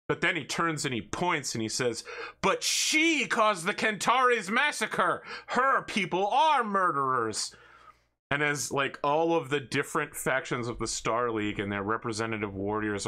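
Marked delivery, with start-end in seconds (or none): 2.4-7.6 much higher, slow hammy accusing cry; dramatic accusation. Acted. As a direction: point and denounce theatrically. Noble accuses in comic.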